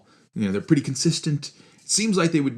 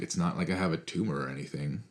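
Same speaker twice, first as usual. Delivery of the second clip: sarcastic; 5 semitones lower, 8 dB quieter than usual. Shown as a demonstration. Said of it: "speaking sarcastically".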